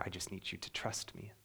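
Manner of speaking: soft unvoiced whisper of God asking for trust; whispered plea. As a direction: breathe it, barely audible, as a gentle plea. God's quiet plea to Job.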